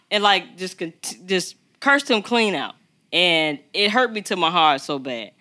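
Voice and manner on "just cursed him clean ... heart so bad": ordinary talk, nothing marked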